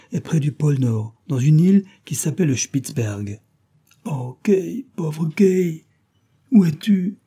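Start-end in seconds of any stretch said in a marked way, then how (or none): 4.0-7.2 high, sighing lament for Kay. Acted. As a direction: mourn for the lost friend. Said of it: "Soupira Gerda".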